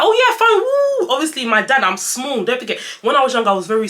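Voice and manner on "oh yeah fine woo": much higher, louder, slow carefree whoop; gleeful kid cheer. Retold or imitated. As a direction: relive a child's carefree delight. Childhood self cheering being carried.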